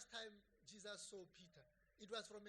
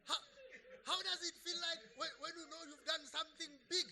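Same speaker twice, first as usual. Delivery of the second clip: loud, higher, emphatic preaching; forceful preaching; 10 semitones higher, 11 dB louder than usual. Real, not acted. Preacher driving home a point to church, forceful preaching. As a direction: preach it out to the congregation with fire.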